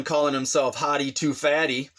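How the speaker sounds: ordinary talk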